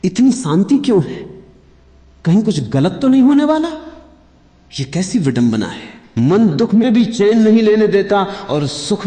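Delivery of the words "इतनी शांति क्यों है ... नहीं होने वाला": higher, rising, hurried worried inner voice; the anxious mind. Retold or imitated. Teacher voicing the listener's fretful mind, higher and hurried. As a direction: voice the restless mind fretting at peace. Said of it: "तो मन कहेगा".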